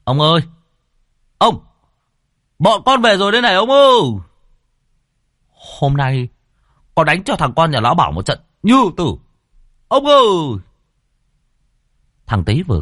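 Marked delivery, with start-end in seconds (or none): 0.0-10.6 high, loud calls across the empty yard; calling out for grandpa. A narrator's reading. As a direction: shout for the old man, excited from the fight. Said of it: "Nó gọi". (gọi to, cao giọng tìm ông)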